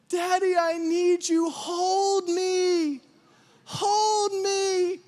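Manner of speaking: high, drawn-out imitated child's wail; mock-tearful whine, stretched long; crying child. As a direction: plead like a sobbing toddler. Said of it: "crying my name".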